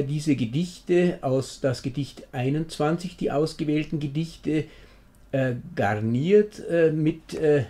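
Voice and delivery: ordinary talk, nothing marked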